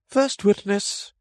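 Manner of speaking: high, slow herald call. A narrator's reading. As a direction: announce the witness to the hall. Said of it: "called out".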